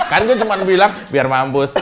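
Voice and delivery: loud, laughing self-defence; laughing confession. Real, not acted. Co-host joking to partner about what he said, laughing. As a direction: say it through real laughter.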